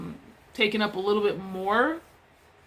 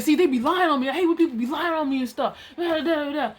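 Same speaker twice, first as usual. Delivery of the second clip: retold indignant griping; 4 semitones higher than usual. Imitating coworker's indignant complaint.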